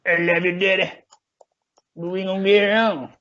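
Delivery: pitched well up, a teasing put-on voice; voix moqueuse de boxeur, aiguë et taquine; mock boxer taunt. Mocking boxer trash talk.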